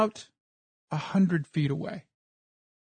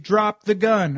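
a loud retold yell, a bit higher; 4 semitones higher, 6 dB louder, slower than usual. Cop yelling at me to drop the gun, loud retold shout. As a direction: bark the order at a man across a clearing. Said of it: "He's saying".

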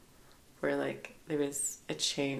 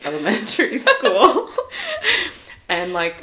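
laughing; 6 semitones higher, 11 dB louder than usual. Real, not acted. Funny lice story, laughing.

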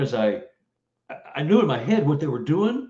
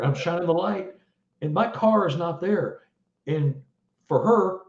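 normal talking voice

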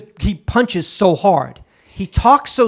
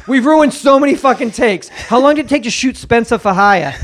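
ordinary talk, nothing marked

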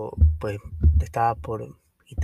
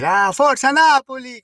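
much higher, louder slow cry of Forza Napoli; fan shout; 14 semitones higher, 6 dB louder, slower than usual. Retold or imitated. The fans all cheer for Napoli together, loud and high. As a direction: yell it like a stadium cheer. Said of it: "Comenzamos todos a gritar".

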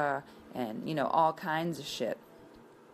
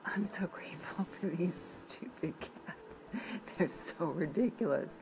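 laughing whisper; 3 semitones higher, 3 dB quieter than usual. Real, not acted. Laughing at her cats.

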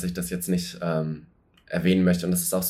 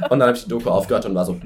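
normal talking voice

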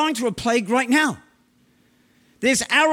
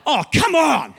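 excited exclamation; 4 semitones higher than usual. Real, not acted. Preacher exulting after scripture.

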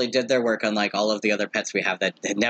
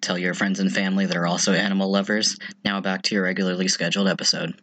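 ad read; 3 semitones lower than usual. Acted. Sponsor read segment.

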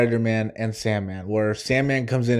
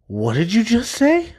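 mock menacing Peter, pitched up; threatening, half-acted; menacing question; 9 semitones higher than usual. Fan imitating Peter confronting Doc Ock, menacing. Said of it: "and then he's like".

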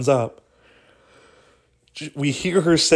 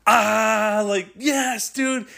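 high, thrilled yes dude; excited cheer; 9 semitones higher, much slower than usual. Retold or imitated. Teens cheering after the prank, high and thrilled. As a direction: celebrate like you got away with it.

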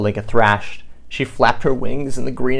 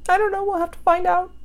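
very high little bird voice; squeaky bird voice; faster than usual. Pretend. Bird answering the child's question.

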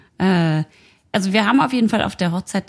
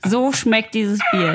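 slow solemn verdict; slower than usual. Acted. Repeated dramatic verdict.

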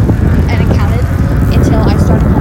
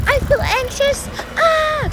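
much higher, slow squeal of fake fright; mock scared yell; 3 dB quieter, much slower than usual. Roller coaster rider yelling before the drop, hammed-up high squeal. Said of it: "someone can yell".

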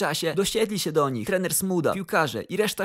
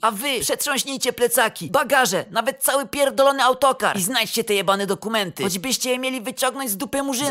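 raised, higher-pitched sweary command; ranting orders; 6 semitones higher, 3 dB louder than usual. A narrator's reading. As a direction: boss ordering a frantic search. Boss barking orders at staff. (rozkazy podniesionym głosem)

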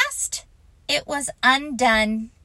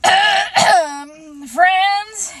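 Eeyore speech; 9 semitones higher, 8 dB louder than usual. Acted. Eeyore opens his speech.

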